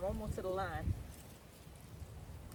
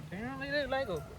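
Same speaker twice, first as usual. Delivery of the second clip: repeated higher and brighter; 6 semitones higher, 7 dB quieter than usual. Real, not acted. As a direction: say it again, pitched up. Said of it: "Said that already".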